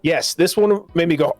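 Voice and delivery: normal talking voice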